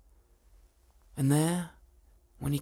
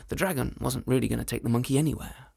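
ordinary delivery

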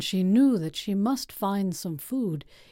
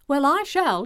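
put-on higher, louder young girl; eager girl voice; 6 semitones higher, 4 dB louder than usual. Girl answering the oven.

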